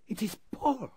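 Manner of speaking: a sister suddenly finding her brother; shocked cry. Acted. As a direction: cry out his name in shock. Woman recognises her brother.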